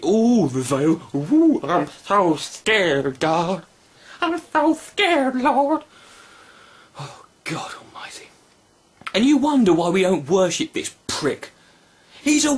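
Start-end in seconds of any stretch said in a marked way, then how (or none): 0.0-5.8 slow hammed-up fake fright; drawn-out sarcastic ooh of fake fear; mock fear. Mock fear at God's threat. 7.0-8.3 whispered sigh of disbelief; whispered groan of disbelief; whispered exasperation. Real, not acted. Exasperated aside.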